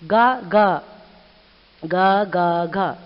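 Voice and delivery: imitated seagull calls, loud at first; seagull cackle. Shown as a demonstration. Teacher shows pupils how seagulls cackle, imitated bird call. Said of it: "this is how the seagull gives the sound".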